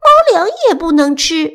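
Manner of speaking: high, loud cat protest; high-pitched feline objection; meowing cat. Acted. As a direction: mew the complaint up high. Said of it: "小咪喵喵地叫道".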